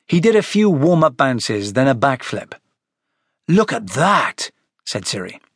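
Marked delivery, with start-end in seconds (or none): 3.5-4.5 slow, admiring wonder; full of admiration. Acted. Siri watching Jack do a backflip, awed admiration. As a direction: stretch it out, impressed. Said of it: "His voice was full of admiration".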